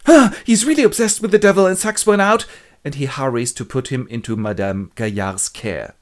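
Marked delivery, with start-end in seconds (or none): 0.1-2.4 high, loud put-on priest voice; alarmed priest. Terrier decides the baby is possessed, high and loud. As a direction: cry it out in alarm. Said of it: "and he goes".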